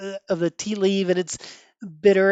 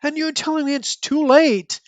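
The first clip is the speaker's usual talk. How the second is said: high-pitched, incredulous rush of words; shocked outburst; 9 semitones higher than usual. Acted. Nightwing reacts to news of being too late, shocked and high-pitched. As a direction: blurt it out in high, stunned protest. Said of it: "Nightwing going like".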